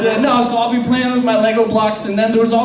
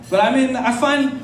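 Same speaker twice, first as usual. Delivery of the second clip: usual speaking voice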